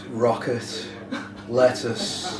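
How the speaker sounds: lightly mock-seductive list reading; mock sultry. Singer teasing the interviewer with a shopping list, mock sultry. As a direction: make groceries sound alluring, tongue in cheek. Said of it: "you could read the shopping list".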